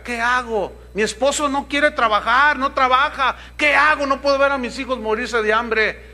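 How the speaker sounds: hammed-up anxious mother; desperate widow. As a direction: beg the pastor for an answer. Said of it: "dirá otra".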